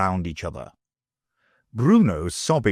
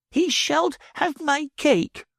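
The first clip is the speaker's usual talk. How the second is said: passionate cry; slower than usual. A narrator's reading. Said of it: "Bruno cried passionately".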